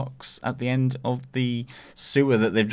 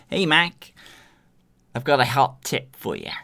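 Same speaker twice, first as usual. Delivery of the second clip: badly faked New York accent, pitched up at the start; mock American accent; 3 semitones higher than usual. Co-host mimicking the phone tip on request in a mock American accent. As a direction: do a forced, hammy American accent. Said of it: "using his American accent".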